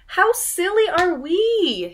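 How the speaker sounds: high, loud, slow character line; a lightly voiced squirrel.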